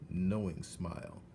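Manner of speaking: usual speaking voice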